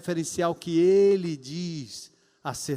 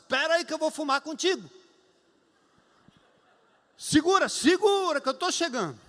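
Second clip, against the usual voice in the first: urgent pastor; 6 semitones higher than usual. Retold or imitated. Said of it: "Eu disse".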